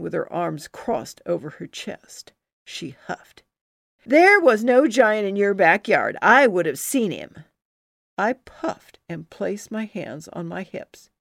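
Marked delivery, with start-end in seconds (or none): 4.0-7.4 louder, scoffing rebuttal; scornful rival. Acted. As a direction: sneer at her story. Susie argues, arms crossed.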